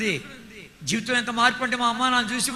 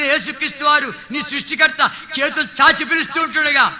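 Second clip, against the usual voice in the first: fiery preaching; 3 semitones higher, 5 dB louder than usual. Acted. Preacher urging the congregation to repent, fiery preaching. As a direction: preach it out to the crowd, full force. Preacher building altar call.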